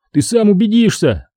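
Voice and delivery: high, earnest assurance; pleading. A narrator's reading. Boy asks father to check gnomes, pleading. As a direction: persuade him to see for himself. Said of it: "просил я его".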